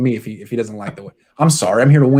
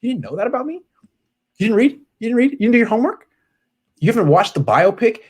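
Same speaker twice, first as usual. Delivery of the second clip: mocking Colin; 9 semitones higher, faster than usual. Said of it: "You can't talk like that to people".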